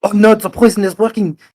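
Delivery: loud, high hammed-up panic; alarmed innkeeper. Innkeeper thinks coughing guest is poisoned, hammed-up alarm. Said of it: "his eyes widen".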